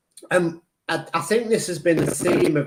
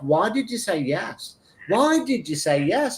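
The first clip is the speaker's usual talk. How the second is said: raised, exasperated self-reproach; arguing with himself; 4 semitones higher than usual. Retold or imitated. Walking uphill, arguing with himself, exasperated. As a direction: scold yourself for agreeing. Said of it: "I started to argue with myself".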